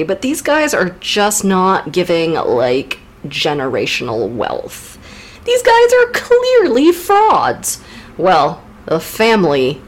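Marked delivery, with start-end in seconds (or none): 5.4-7.8 much higher, slow mocking scorn; scornful doctor. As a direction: drag it out in disbelief. Doctor's reaction voiced.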